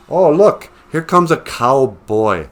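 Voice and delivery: high, loud mock excitement, then slowing; mock excited. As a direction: gush like a kid spotting a cowboy. Mocking onlookers pointing at cowboy.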